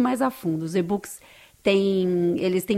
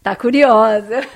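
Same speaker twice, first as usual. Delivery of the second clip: slow, laughing and louder, teasing; laughing tease; 7 dB louder, slower than usual. Real, not acted. Guest teasing the curious interviewer, laughing.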